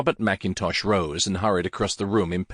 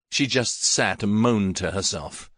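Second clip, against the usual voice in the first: ordinary delivery, nothing marked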